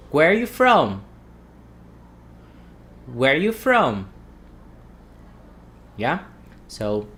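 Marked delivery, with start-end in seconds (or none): 0.1-4.1 connected "Where you from?" with no "are", falling on "from"; "are" omitted. Shown as a demonstration. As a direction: model "Where you from?" as one linked phrase without "are". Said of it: "let's omit the R".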